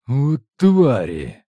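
slow, loud angry growl; gruff growl. Acted. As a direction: growl the curse in frustration. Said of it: "прорычал командир наёмников".

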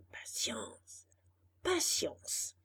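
soft resigned whisper. A narrator's reading. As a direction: hush it to yourself. Donkey repeats to himself.

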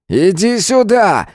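high, slow, drawn-out barked command; barked order. Acted. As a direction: bark it as an order. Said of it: "гаркнул Свободин".

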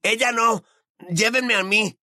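high, desperate plea; frantic protest without much extra volume; Ron shouting. A narrator's reading. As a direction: beg in a strained high cry. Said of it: "gritó Ron".

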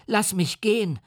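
put-on high, frightened child's cry; screaming child. A narrator's reading. The boy screams at the creature, high and frightened. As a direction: shriek it like a terrified little boy. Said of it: "schrie er".

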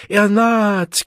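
high, loud piercing cry; shrill yell. A narrator's reading. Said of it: "gellte Bernhard Bokanowski".

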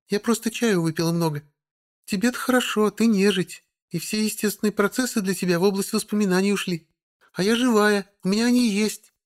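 high, bristling witch; snappy witch voice. A narrator's reading. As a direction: snap back, offended. Witch snaps back.

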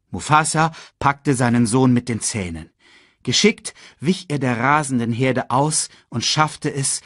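plain reading voice

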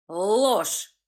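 cutting, rumbling accusation; sharp rumbling snap. Acted. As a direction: crack the word like a whip. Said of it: "хлёстко пророкотал Тихомир".

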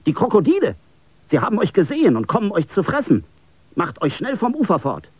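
high, loud, fast alarm call; urgent warning shout. A narrator's reading. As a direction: warn them off the bank, hurried. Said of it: "rief einer der Soldaten".

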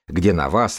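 normal talking voice

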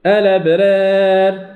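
الأبرار recited slowly, alif leaning toward e; imala in recitation. Shown as a demonstration. Teacher recites examples of imala. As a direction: draw out الأبرار with tilted alif. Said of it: "كذلك".

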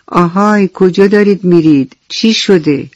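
usual speaking voice

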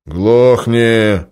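loud, sharp shut-up; curt order. Acted. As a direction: cut him off loudly. Boss silences Bashka.

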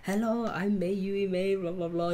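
slow, put-on American-y English accent; mock American-y English accent. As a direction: greet like an idol in careful American-y English. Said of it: "kind of American-y, accent-y, like, English".

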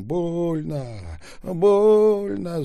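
high, drawn-out cries of pain; pained cry. A narrator's reading. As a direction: wail it, building to a loud shout. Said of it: "закричал Таляпа". (протяжный крик боли, по нарастающей)

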